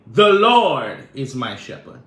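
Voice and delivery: loud, bold declaration of Lord is my shepherd; boldly. Shown as a demonstration. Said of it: "I will boldly say".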